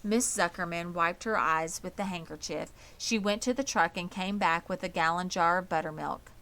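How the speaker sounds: plain reading voice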